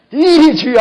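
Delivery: high, very loud mimicked cry; outpouring prayer cry. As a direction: burst out calling on the Lord. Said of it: "막 쏟아내고". (터져 나오듯 크게 부르짖으며)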